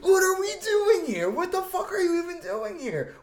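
very high, loud incredulous rant; hammed-up exasperation, squeaking up high; acted outraged disbelief. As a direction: act out losing it over an absurd answer. Acts out his outraged disbelief.

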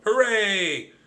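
high, loud cheer. A narrator's reading. Cousin celebrating the found map, cheering. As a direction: whoop it out in celebration.

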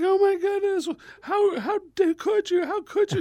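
very high-pitched mock maternal outrage; horrified mother. Mom hearing son swore, high horrified scolding. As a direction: imitate a scandalized mom in a high voice.

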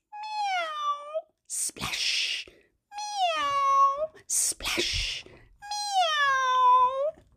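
high soft meow; meowing cat. Pretend. Teacher voices the small wet cat. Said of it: "Cat says".

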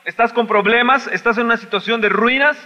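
high, loud impassioned preaching; shouting sermon, pleading with God; fiery preaching. Acted. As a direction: preach it at full force, crying out to God. Loud preaching questions to congregation.

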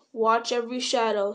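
plain reading voice

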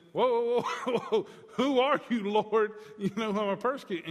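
high, loud startled whoa, with a laugh; startled shock. Retold or imitated. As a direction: blurt it out in shock. Startled Saul voiced by preacher.

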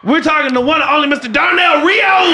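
big hype introduction; announcer shout. Acted. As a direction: call the name out to the crowd. Host introducing guest.

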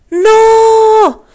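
piercing cry of terror, very high and loud; terrified scream. A narrator's reading. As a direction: scream as your brother falls toward the concrete. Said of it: "Yo mismo exclamé asustado".

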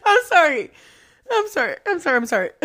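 high, settling out of a laughing fit; repeated apology, pitch coming back down; giggly apology. Real, not acted. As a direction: squeak it out while recovering from laughter.

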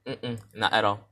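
ordinary delivery

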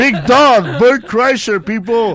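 loud, high hype-man shout; rowdy hailing. Real, not acted. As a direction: hail the big guest for all to hear. Hailing the guest as he arrives.

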